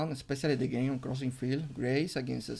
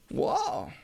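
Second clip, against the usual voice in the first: amazed. Real, not acted. Reacting to a strong game.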